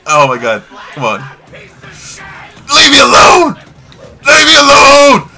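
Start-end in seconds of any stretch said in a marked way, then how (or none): 1.5-3.6 long, stretched, high and loud scream; drawn-out terrified yell. Real, not acted. Being attacked in horror game. 4.2-5.3 quick, high, loud scream; short terrified yell. Real, not acted. Being attacked in horror game.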